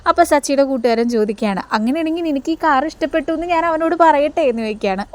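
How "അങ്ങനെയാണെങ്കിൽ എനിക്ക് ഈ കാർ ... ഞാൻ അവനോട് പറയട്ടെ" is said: raised voice. Retold or imitated. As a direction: pitch it up and call it out for the eavesdropper. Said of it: "ഒച്ചയെടുത്ത് പറയുകയാണ് കേട്ടോ".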